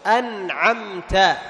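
"أنعمت" very slow with the nun and the mim each clearly sounded. Shown as a demonstration. Teacher modelling clear nun and mim for the class, slow. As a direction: draw out "أنعمت" and sound the nun before the ain. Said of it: "فتظهر سكون النون وتظهر سكون الميم".

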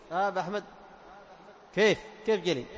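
ordinary talk

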